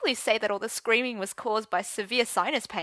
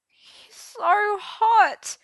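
loud, high, drawn-out wail; miserable gulp; 13 semitones higher, 9 dB louder, much slower than usual. A narrator's reading. Arthur tearing up over Gawain, miserable high wail. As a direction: wail it slowly through tears. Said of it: "he gulped miserably".

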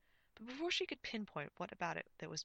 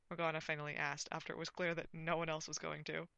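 plain reading voice

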